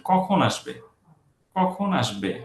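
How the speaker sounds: কখন stressed high, আসবে going down; falling intonation. Shown as a demonstration. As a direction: punch কখন and let আসবে drop. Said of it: "আসবেতে কিন্তু বাংলাতেও নিচে যাচ্ছে".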